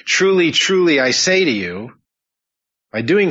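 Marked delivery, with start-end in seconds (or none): 0.0-1.9 solemn, raised announcement of truly truly; emphatic truly truly. Shown as a demonstration. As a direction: ring out the opening truly like a summons. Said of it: "to introduce this passage".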